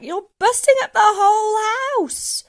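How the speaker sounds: high, loud protest; alarmed boy shouting; calling back. A narrator's reading. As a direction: yell up in dismay. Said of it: "George called back".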